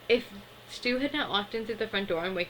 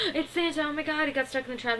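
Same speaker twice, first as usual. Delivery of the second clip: high, quick mock excitement; breathless-with-excitement retold squeal; excited babies, imitated; 7 semitones higher, faster than usual. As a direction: gush it high and fast like thrilled little kids. Babies excitedly spot Santa, retold.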